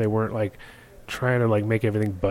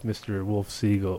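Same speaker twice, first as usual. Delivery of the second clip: ordinary talk, nothing marked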